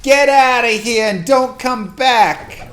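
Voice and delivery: loud, slow, high Texas yell at the buyer; Texas accent. Retold or imitated. Shouting at a car buyer to leave, in a loud Texas accent. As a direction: drawl it out like an angry Texan chasing someone off. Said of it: "That's my Texas accent".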